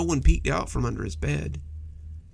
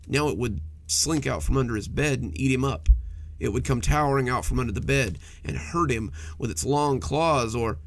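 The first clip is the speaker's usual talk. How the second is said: ordinary delivery, nothing marked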